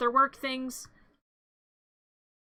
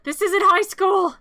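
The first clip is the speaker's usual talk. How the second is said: scolding Gerald; 6 semitones higher, 7 dB louder than usual. Real, not acted.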